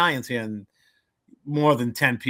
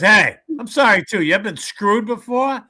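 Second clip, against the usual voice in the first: higher and louder, brassy Bronx broad; brash Bronx girl; 9 semitones higher, 8 dB louder than usual. Acted. Joke's Bronx girl offers the armless man a tease, brash and loud. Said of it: "Third girl was from, let's say, the Bronx".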